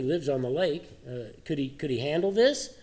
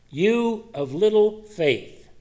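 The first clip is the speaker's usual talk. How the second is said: slow, higher, louder stern rebuke; scolding rebuke; 6 semitones higher, 4 dB louder, much slower than usual. Acted. Jesus scolds Peter for doubting, slow stern rebuke. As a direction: drag out each word like a scolding master. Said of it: "So Jesus scolds him".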